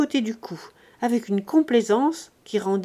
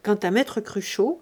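plain reading voice

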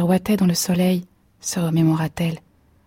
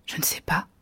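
much softer, whispered daze; barely voiced confusion; dazed; 3 semitones lower, 9 dB quieter than usual. A narrator's reading. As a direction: murmur it, lost in thought. Dazed wife repeats.

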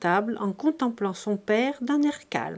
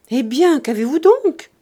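loud, higher, rising bewilderment; astonished question; 4 semitones higher, 8 dB louder than usual. A narrator's reading. Charles sees the women's sudden panic, astonished. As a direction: ask loudly, taken aback.

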